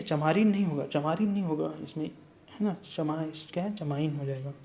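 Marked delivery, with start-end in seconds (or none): none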